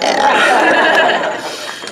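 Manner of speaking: airless, high giggle; quick breathy laugh. Real, not acted. Laughing at lunch story.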